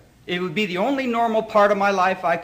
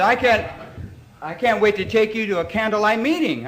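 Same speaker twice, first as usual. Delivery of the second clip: gushing high voice; 5 semitones higher, 4 dB louder than usual. Retold or imitated. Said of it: "And he said".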